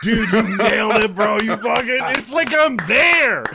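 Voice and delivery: high, loud, laughing praise; hype friend cheering. Real, not acted. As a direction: hype up your buddy's impression. Friend cheers a perfect imitation.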